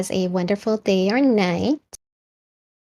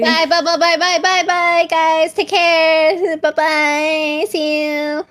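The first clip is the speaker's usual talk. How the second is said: high cheery goodbye; 8 semitones higher than usual. Real, not acted.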